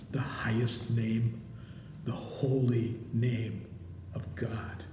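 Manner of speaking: hushed and deliberate, sinking away at the end; hushed reverence. Real, not acted. Preacher naming God's unspeakable name to the congregation, hushed reverence.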